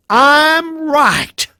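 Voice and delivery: loud, high, drawn-out cry; defiant shout. Acted. Job confronting God face to face, defiant shout.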